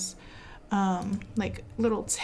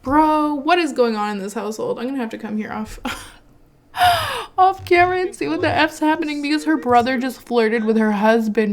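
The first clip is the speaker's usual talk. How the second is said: loud, fast, scandalized rant; 3 semitones higher, 7 dB louder than usual. Real, not acted. Gamer outraged that the brother flirted with the husband, loud. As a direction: rant like you caught them red-handed.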